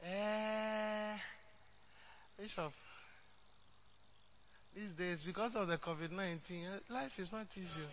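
softly mimicking a hesitant excuse maker; mock stingy member. Mocking a church member who offers only ten cedis, hushed. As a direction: play the reluctant giver, hushed and hedging. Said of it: "You will say that".

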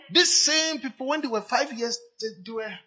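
high, loud preaching at full pitch; full-force preaching. Real, not acted. Preacher urges the young congregation, preaching loudly. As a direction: hammer it home from the pulpit.

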